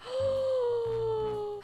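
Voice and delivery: high excited gasp; delighted gasp. Real, not acted. Gamer gasping at a cute find, high delighted gasp. Sees something she loves.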